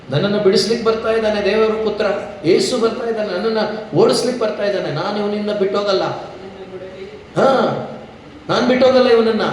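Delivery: high, urgent protest of a demon; defiant demon. Acted. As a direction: act out a spirit refusing to let go. Voices demon resisting approaching Jesus.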